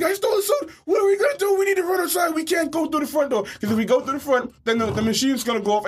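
high, frantic panic, half shouted; a kid sure he's about to be caught for the suit; panicked kid. Retold or imitated. Retold kid panicking in a high shout. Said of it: "I'm like".

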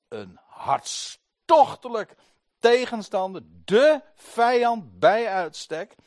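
hoog, luid en langzaam gepreekt; preken met vuur. Acted. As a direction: breng het met volle kracht. Preken op volle kracht. (preker die de hartstocht van Paulus benadrukt)

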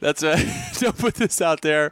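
laughing through the words; can't stop laughing while quoting. Real, not acted. Laughing at caller's voicemail.

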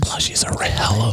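slow, high ASMR whisper; drawn-out whisper into the mic. Shown as a demonstration. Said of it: "I'm gonna whisper this into the microphone".

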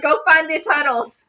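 high and loud retold command; excited urging. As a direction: urge a friend on eagerly. Acting out her excited urging.

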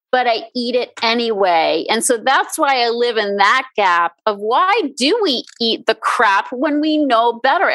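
ordinary talk, nothing marked

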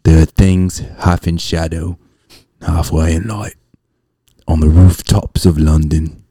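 slow Cockney-style recital fading to a whisper; chimney sweep's wistful verse; theatrical verse recital. Acted. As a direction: recite in measured phrases, ending hushed. Recites Mary Poppins verse as quote.

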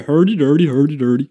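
sing-song mock-Swedish gibberish; Swedish Chef. Guest imitating how people fake Swedish, Swedish Chef gibberish. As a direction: babble like a cartoon Swedish cook. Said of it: "look at Swedish Chef and go".

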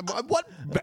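ordinary talk, nothing marked

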